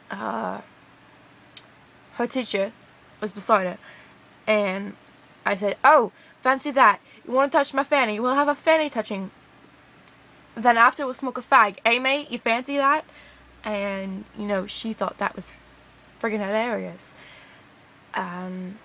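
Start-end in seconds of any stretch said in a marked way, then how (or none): 5.8-13.0 higher, louder hammed-up British come-on; cheeky mock-British banter. Telling what they said to a mate with the teacher nearby, hammed-up British banter. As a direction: play up the British slang, lifted and cheeky.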